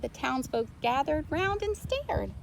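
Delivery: ordinary delivery, nothing marked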